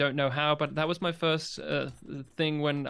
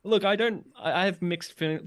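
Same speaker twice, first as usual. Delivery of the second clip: normal talking voice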